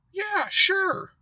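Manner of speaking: imitating him in a very high-pitched voice; high-pitched put-on reply; mock breezy agreement. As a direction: squeak out his agreement, mockingly high. Acting out the man's eager agreement.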